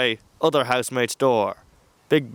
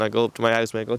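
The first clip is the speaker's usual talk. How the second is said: plain reading voice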